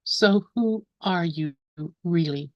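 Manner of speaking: slow, measured recital with long pauses between lines; slow poem reading. Real, not acted. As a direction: read each line slowly, leave room to contemplate. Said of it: "gonna read the poem slowly".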